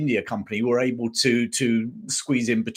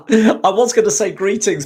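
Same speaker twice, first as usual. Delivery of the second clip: real laughter breaking into the words, higher and louder; laughing; 8 semitones higher, 8 dB louder than usual. Real, not acted. Host amused by a viewer's hard name, laughing. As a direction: say it through a laugh, pitched up.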